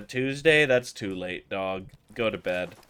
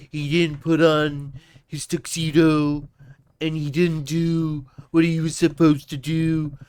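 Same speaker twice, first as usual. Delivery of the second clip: Trump impression; 2 semitones higher, 6 dB louder than usual. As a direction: scold like Trump, getting louder at the end. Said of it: "did my ... on my Donald Trump shit".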